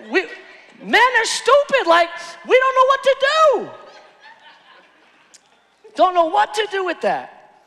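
high, loud comic panic; exasperated outcry. Acted. Husband tells the ladies men are clueless, exasperated high outcry. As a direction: cry it out high, at a loss.

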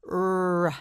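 "r" as a long held rrr-uh, not the letter's name; isolated R sound. Shown as a demonstration. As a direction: hold the sound of "r", releasing into uh. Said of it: "The letter R makes the sound".

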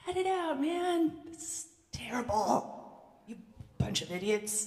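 high, mock angry scolding; hammed-up rant at the soldiers; Jesus pretend-yelling at soldiers and friend, mock angry; mock angry outburst. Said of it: "Did he start screaming at the soldiers".

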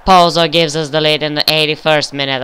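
ordinary talk

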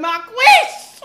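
loud high call across the salon. Retold or imitated. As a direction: shout her name to get attention. Calling out to her hairdresser.

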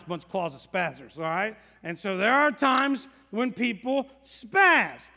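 ordinary delivery

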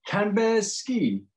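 high, slow, drawn-out question; Dad asking again. Acted. Dad Bear asking his son again, high and slow. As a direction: stretch each word like a puzzled repeat. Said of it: "When Dad Bear asks again".

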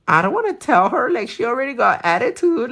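a light whiny protest; mock whine. Telling her husband she won't confront the cashier, whiny. Refusing husband's push to complain.

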